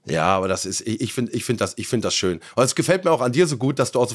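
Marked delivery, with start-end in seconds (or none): none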